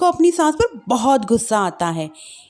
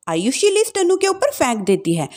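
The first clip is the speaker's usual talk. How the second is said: plain reading voice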